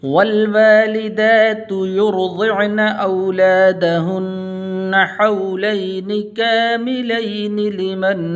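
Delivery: full tajweed recitation with long held vowels; Quran recitation. Acted. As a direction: recite the whole passage as a flowing chant. Full recitation after the lesson.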